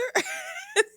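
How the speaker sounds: high-pitched burst of laughter; loud, high cackling laugh; real laugh. Real, not acted. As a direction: burst out laughing at her claim. Laughs at Maggie's claim.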